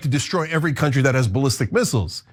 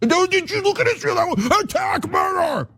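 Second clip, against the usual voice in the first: shrill, much higher mocking shout, slowing on attack murder; mock Lindsey Graham. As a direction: screech it like a frantic warmonger caricature. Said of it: "That's Lindsey Graham".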